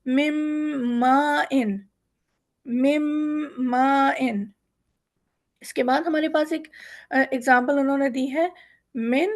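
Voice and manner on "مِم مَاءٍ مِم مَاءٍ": مِم مَاءٍ drawn out, noon melted into a held nasal meem; very slow recitation demo; very slow ghunna. Shown as a demonstration. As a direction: hum the meem long before مَاءٍ. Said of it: "نون کا غنہ نکالتے ہوئے میم کو ملائیں گے".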